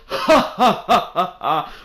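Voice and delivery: a drawn-out hammed-up ha ha ha; forced laugh. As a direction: laugh it all out on purpose, slowly. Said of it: "laugh it all out".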